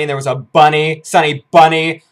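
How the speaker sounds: loud, delighted outburst; excited gushing. Real, not acted. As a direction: punch it out loudly, thrilled by the detail. Gushing over a favorite moment.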